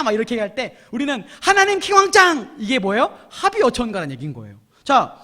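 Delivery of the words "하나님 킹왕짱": loud, high, stretched-out cheer; exuberant cheer. Acted. Preacher voicing Moses' praise song as a slogan, exuberant cheer. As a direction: shout it like a fan's slogan.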